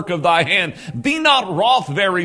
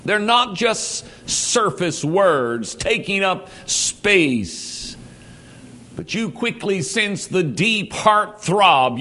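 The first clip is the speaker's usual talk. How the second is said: usual speaking voice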